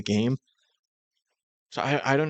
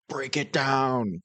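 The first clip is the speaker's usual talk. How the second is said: drawn-out, mild mock shout; half-hearted imitation of yelling; 3 semitones higher, much slower than usual. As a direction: imitate a shouted command, stretched slow. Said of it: "why are we shouting".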